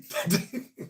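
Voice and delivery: quiet, voiceless chuckle; soft laugh. Real, not acted. Host amused at not knowing a player, soft laugh. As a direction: let out a soft breathy laugh.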